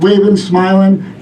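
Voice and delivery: ordinary talk, nothing marked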